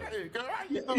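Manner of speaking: put-on high, soft girlfriend greeting with a laugh; mama gushing to a friend on the phone; friendly phone chatter. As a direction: gush to your friend on the line.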